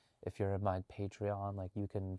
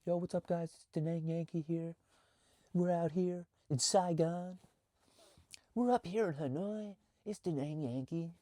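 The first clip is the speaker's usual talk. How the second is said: hyped YouTuber intro; 8 semitones higher than usual. Shown as a demonstration. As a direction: pump it up like a cringey vlog intro. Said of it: "It just seems kinda cringy".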